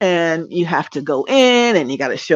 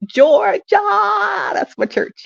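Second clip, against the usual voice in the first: excited; much slower than usual. Real, not acted. Proud shout-out of her church.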